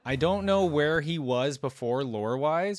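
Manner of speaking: ordinary delivery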